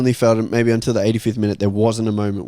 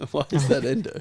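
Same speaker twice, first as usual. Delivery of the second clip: laughing; 3 semitones higher, 7 dB quieter than usual. Real, not acted. Laughing at a guess.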